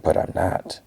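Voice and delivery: softly voiced admission, half-whispered; a little quietly. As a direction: drop it to a near-whisper. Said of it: "a little quietly".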